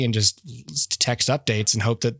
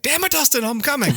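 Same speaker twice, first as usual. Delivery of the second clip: much higher, exasperated mock outburst; a fake burst of annoyance at being interrupted; 14 semitones higher than usual. Exasperated at friend's text mid-chores.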